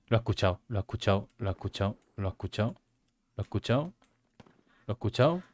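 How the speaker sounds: soft repetition, dropping away; fading murmur. Real, not acted. Repeating a phrase softer and softer.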